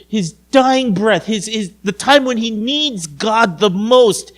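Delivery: ordinary talk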